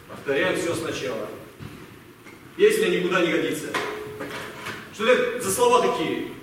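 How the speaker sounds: ordinary delivery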